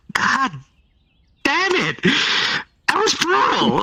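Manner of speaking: high-pitched stunned outburst; excited exclamation. Retold or imitated. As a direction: blurt it out, shocked and thrilled. Gamers reacting to brutal scene.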